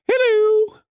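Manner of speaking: high sing-song hello, imitating the boss; boss's greeting. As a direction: chirp it way up like a cheery boss. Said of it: "And he'd go".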